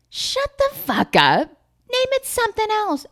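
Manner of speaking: high, loud comic fury; mock outrage. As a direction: squeal your indignation, hammed up. Rants at Texas naming Paris.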